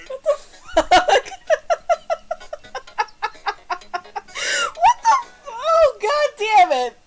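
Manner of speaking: loud, laughing, cursing through tears of laughter; laughing yell. Real, not acted. Player yelling at the game through laughter. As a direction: crack up and yell at the screen.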